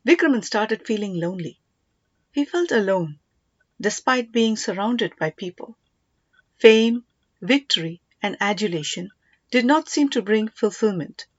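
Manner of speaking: ordinary delivery, nothing marked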